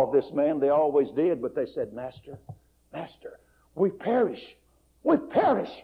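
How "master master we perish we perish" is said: desperate plea. Acted. Disciples begging in the storm.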